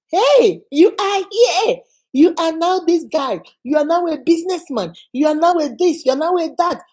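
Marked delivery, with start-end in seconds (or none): 0.1-1.8 excited. Real, not acted. Preacher exclaiming to fathers.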